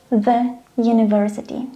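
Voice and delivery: THE said "thuh" before university. Shown as a demonstration. Teacher demonstrating an exception, THE as "thuh". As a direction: say "thuh" for THE, university opening on a Y sound.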